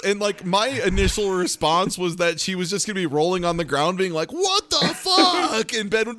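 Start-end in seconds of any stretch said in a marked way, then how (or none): 4.3-5.7 high, slow, laughing disbelief; mock shocked. Imagining Hayden rolling on the ground, in high mock shock. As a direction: squeal it out in fake shock. Imitating a character's reaction.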